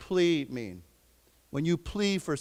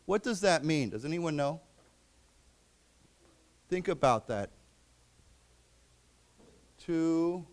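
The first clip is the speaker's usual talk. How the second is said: usual speaking voice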